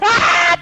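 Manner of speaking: sharp high shout of shock; loud startled cry; startled yelp. Real, not acted. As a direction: yelp as the jump scare hits. Sudden shocked cry in game.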